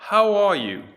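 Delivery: called-out prompt. Shown as a demonstration. Teacher calling out the English cue to the class, loud and high. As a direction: sing out How are you? like a prompt card.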